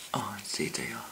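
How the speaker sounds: soft breathy whisper of delight; hushed aside to the viewers. Real, not acted. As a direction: whisper it as if to yourself. Excited whisper over makeup haul.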